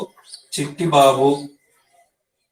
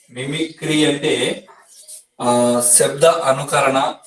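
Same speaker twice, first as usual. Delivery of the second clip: slow, drawn-out definition with pauses; slow dictation; slower than usual. Real, not acted. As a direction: dictate it slowly as you write. Dictating a definition while writing it. (నెమ్మదిగా, సాగదీసి చెప్పడం)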